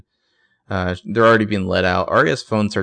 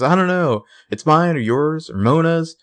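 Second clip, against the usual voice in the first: high, louder, slowed frantic lines; panicked Spencer; 7 semitones higher, 4 dB louder than usual. Retold or imitated. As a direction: let the panic climb in a high voice. Spencer panicking, voiced in recap.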